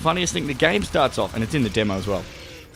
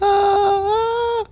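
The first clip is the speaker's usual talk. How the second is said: high, wordless held singing notes; failed high notes; 4 dB louder than usual. Retold or imitated. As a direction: strain for a high note without words. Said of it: "could not get any of the high notes".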